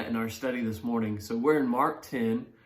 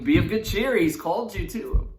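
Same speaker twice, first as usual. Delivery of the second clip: cheerful encouragement; 6 semitones higher, 5 dB louder than usual. Retold or imitated.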